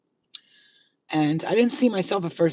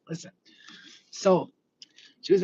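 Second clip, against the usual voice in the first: ordinary talk, nothing marked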